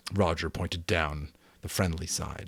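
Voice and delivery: ordinary delivery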